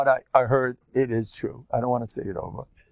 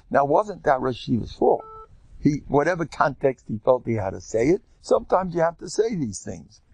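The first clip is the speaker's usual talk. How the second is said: normal talking voice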